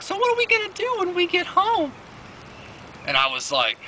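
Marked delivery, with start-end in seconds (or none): 0.0-1.9 very high falsetto mock girlfriend; girlfriend's voice. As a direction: squeak the question like her girlfriend. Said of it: "she was like".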